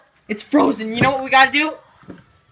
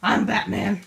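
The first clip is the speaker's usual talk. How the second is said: low, softer superhero voice; Batman; 6 semitones lower, 5 dB quieter than usual. Pretend. Cook answering kid's rival claim, low soft Batman voice. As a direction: drop it low for the hero line. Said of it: "You're Batman".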